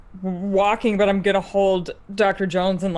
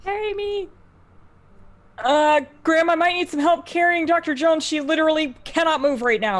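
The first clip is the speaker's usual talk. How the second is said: high in-character voice; 8 semitones higher than usual. Acted. Character asks Graham for help.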